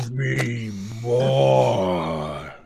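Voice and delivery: drawn-out wailing monster demand; slow hungry monster groan. Acted. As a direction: stretch it out like a starving beast. Boiler monster demands coal.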